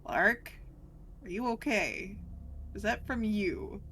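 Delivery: normal talking voice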